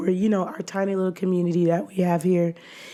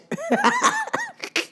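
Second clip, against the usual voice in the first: high laugh. Real, not acted. Laughs at own joke.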